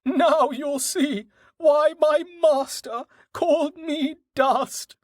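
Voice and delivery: very high, slow horse cry; triumphant high-pitched animal voice; boastful horse. A narrator's reading. Horse Dust boasts while galloping.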